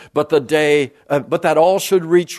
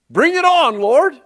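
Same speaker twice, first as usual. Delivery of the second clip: eager cry; 8 semitones higher, 3 dB louder than usual. Real, not acted.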